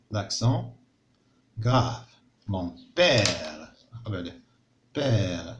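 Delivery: ordinary talk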